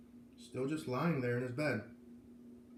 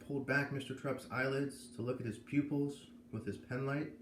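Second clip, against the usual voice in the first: ordinary delivery, nothing marked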